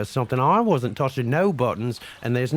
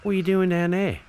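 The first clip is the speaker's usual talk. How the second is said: put-on Australian accent; 3 dB quieter than usual. Put-on Aussie accent throughout.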